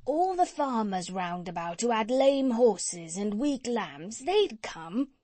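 chatty, eager tradesman voice; cat's meat man. Acted. Cat's meat man talking to the doctor, eager and folksy. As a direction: play a folksy meat seller pitching his idea.

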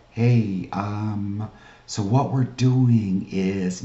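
slow, dragged-out, unsure pitch; hesitant sales opener. Shown as a demonstration. Coach showing the wrong way to open a call, slow and hesitant. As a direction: stall and stretch it, no yes in the voice. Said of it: "You're not talking to 'em like".

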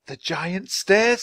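high, slow, rising disbelief; surprised question. A narrator's reading. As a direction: repeat it wonderingly with rising pitch. Annie repeats the name in surprise.

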